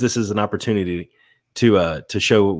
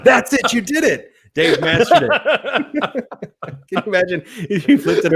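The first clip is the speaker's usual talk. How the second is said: laughing; 12 semitones higher than usual. Real, not acted. Laughing praise after robot attempt.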